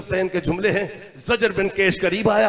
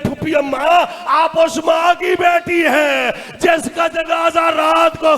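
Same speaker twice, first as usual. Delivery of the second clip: high, loud, chant-like lament; full-voiced recited cry of grief; mourning wail; 6 semitones higher, 5 dB louder, slower than usual. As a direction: wail slowly, drawing out each phrase. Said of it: "मातम करके कहते हैं".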